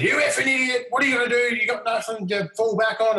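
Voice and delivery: high-pitched furious mock yell; mum yelling. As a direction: play the mum losing it at her son. Quiet mum suddenly yelling at son.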